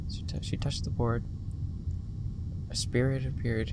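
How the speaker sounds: hushed recap of the spirit's arrival; quiet retold reading. Shown as a demonstration. Streamer quietly rereads the game text, hushed. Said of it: "This is what I got".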